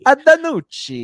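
high, loud hammed-up Italian accent. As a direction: ham it up like an excited Italian. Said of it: "so I can do that accent".

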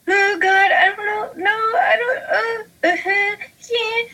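very high, stammering panic; mock freaking out. Nervous fan before the mic, very high flustered panic. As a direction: squeal the nerves, slowing on no. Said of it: "And I was freaking out".

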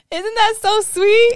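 high, slow sugary gush. Pretend. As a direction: coo it like it's adorable. Gushing over the song.

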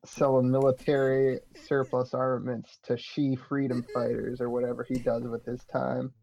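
usual speaking voice

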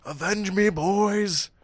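high, loud dying plea; overacted death scene. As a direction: ham up a dying hero's last words. Quoting Red Dawn death scene.

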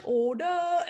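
high, drawn-out British "ordah"; British-sounding command. Retold or imitated. Cadet mimicking others calling order arms, British-sounding command. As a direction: imitate a drill command with a British-sounding ending. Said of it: "it already sounds a little bit British".

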